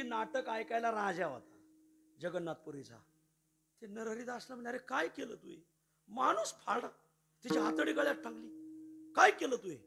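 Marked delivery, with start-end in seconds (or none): 4.7-9.8 loud, higher outraged rebuke; king rebuking Narhari angrily; scolding king. Retold or imitated. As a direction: demand an answer, furious.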